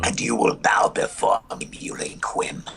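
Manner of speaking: whispery villain voice; booming demon lord. Retold or imitated. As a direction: rasp it out like a sneering robot villain. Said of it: "You sound like Starscream, not Loki".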